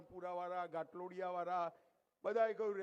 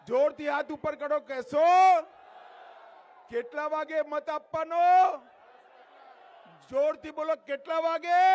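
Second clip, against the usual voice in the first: raised, forceful call-and-response; rallying shout; 4 semitones higher, 10 dB louder than usual. Real, not acted. Politician asks crowd to confirm voting, rallying shout. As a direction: shout for a pledge from the whole crowd. Demanding loud pledge from crowd.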